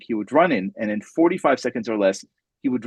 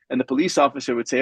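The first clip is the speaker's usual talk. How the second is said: ordinary talk, nothing marked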